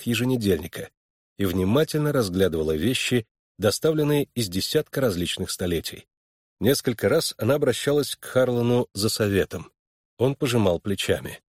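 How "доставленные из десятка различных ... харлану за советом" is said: ordinary delivery, nothing marked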